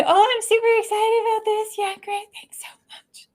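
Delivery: high, sing-song forced cheer fading out; fake enthusiasm. Shown as a demonstration. Introvert thanking a party host, hollow over-the-top enthusiasm. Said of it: "My words are telling you".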